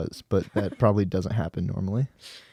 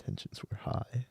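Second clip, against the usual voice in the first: hushed whisper with a questioning lift; giggly prank whisper; 2 semitones higher, 13 dB quieter than usual. Acted. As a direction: whisper it into the mic like a secret. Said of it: "Jay loves it when we whisper into the mic".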